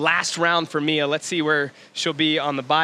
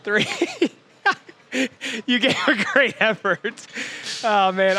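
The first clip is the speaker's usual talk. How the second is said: real laughter breaking up the words, higher pitch; laughing; 6 semitones higher than usual. Real, not acted. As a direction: crack up while trying to keep commentating. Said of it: "I'm laughing 'cause I know".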